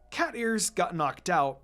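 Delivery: plain reading voice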